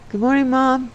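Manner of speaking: put-on high, bright-pitched little boy greeting; lightly lifted child's voice; young son. A narrator's reading. Uriel greeting his mother on the throne, boyish.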